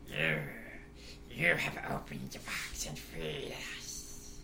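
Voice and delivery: slow eerie whispered sprite; breathy little fairy hissing close to the ear; pixie voice. Acted. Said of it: "He's doing the pixie voice".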